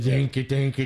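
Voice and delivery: spoken dinky dinky like a twangy guitar; mock guitar strum. Mocking a busker's out-of-tune guitar, strummed sound. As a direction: plunk the words out like bad strings. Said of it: "that fucking guitar".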